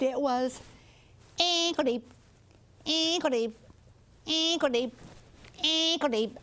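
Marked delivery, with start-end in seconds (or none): 1.4-6.4 higher, chirped little frog reply; peeper frog answer. Acted. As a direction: chirp it back like a tiny peeper. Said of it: "they'd call back and tell him".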